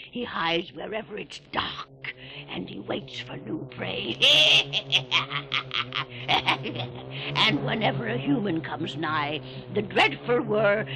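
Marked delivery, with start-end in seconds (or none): 4.2-7.3 deep, rising sinister chuckle; low cackle. Acted. Keeper relishing the Whir's hunt, low cackle. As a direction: chuckle darkly at the horror.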